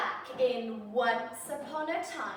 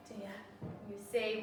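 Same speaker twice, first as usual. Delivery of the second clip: ordinary delivery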